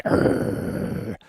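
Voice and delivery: low-volume imitated growl; dog growl. Dog answering a stranger's command, imitated growl. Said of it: "he needed to growl at them".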